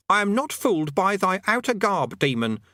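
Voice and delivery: high, mock-archaic grand tone; theatrical archaic pronouncement; pompous conjurer voice. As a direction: play the solemn demon-summoner. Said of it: "In a more normal voice".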